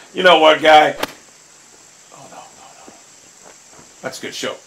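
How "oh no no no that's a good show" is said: whisper. Real, not acted. Hushed while watching ball.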